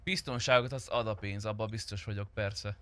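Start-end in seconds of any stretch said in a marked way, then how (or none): none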